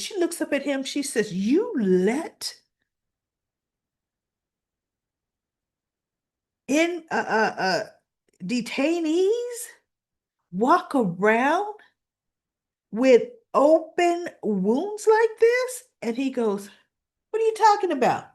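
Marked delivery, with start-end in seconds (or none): 1.3-15.8 slow, high, incredulous challenge; indignant disbelief. Retold or imitated. Doctor confronting guard over untreated wounds, indignant disbelief. 17.3-18.3 high, loud brush-off; dismissive protest. Retold or imitated. Guard brushing off the doctor's complaint, dismissive protest.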